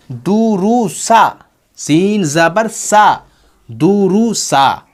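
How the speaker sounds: very slow "दुरुसा", long u's then "सा"; zabar joining demo. Shown as a demonstration. Teacher changing the last sign to zabar, drawn-out "दुरुसा". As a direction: stretch every syllable of "दुरुसा, सीन ज़बर सा". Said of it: "ज़बर रख देंगे".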